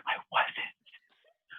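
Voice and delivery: whispered I wasn't; hushed denial. Retold or imitated. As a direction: breathe the denial under your breath. Quiet denial to mom.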